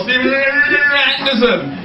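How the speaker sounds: drawn-out mock MC introduction; slow, hammed-up announcer echo. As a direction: stretch his name like a compère. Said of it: "driven down with this guy".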